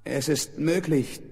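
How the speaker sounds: ordinary delivery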